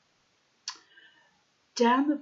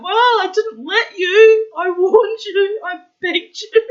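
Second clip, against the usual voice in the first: laughing; 12 semitones higher, 14 dB louder than usual. Real, not acted. Laughing protest.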